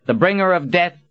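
high, loud ceremonial proclamation; officious. A narrator's reading. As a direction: announce the title like a stiff herald. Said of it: "Verdine said in an officious tone".

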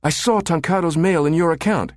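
high, strained woman's voice; urgent high accusation; accusing captive. A narrator's reading. Held Susan accuses her captor.

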